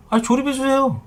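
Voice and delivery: high mock whine of "조립해주세요"; lightly mocking the customer's voice; mocking rude customer. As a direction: parody her entitled demand. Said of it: "싸가지 없".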